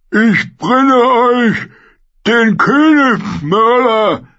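thick, smacking voice, very high and slow; squelching flesh creature. A narrator's reading. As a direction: drag each word out wetly, triumphant. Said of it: "mit seiner dicken, schmatzenden Stimme".

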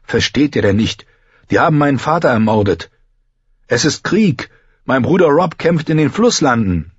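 high, urgent appeal; impassioned boyish explanation; pleading young John. A narrator's reading. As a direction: make them understand, pitch climbing. John pleads with his friends.

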